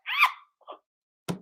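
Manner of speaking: very high reenacted shriek; mock shriek. Host reacting to body bag threat, mock shriek. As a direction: shriek way up high without extra volume.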